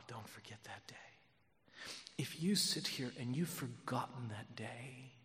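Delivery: hushed, half-whispered tenderness; moved, near tears. Real, not acted. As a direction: speak softly, on the edge of crying. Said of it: "I'm sorry, I'll start crying".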